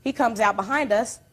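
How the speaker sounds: normal talking voice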